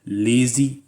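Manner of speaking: लेजी said with a buzzing z sound at the end; teacher modelling लेजी for the class to repeat; clear pronunciation demo. Shown as a demonstration. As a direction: stretch the z in लेजी. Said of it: "मेरे साथ pronounce कीजिए word".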